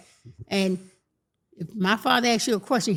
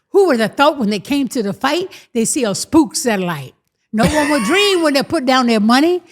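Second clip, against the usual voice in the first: louder boastful poem recital as Clay; mimicking Cassius Clay; 4 dB louder than usual. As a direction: brag the rhyme like a young Ali. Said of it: "say it the way I said it".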